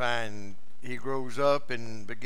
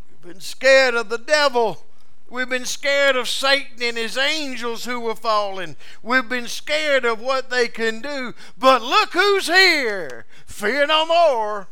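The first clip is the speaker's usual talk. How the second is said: louder, higher, building sermon cadence; fiery preaching; 7 semitones higher, 6 dB louder than usual. Acted. Preacher rousing the congregation about Jesus' authority, rising fervor. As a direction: build it up with full preaching fire.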